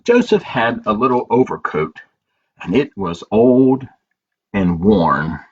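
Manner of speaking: put-on slow, gentle read-aloud for children; story narration. A narrator's reading. Librarian reading a picture book to children, gentle storytelling.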